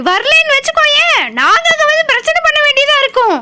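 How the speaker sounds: high-pitched, insistent telling-off; angry scolding. Acted. Mother-in-law ordering her daughter-in-law home, scolding.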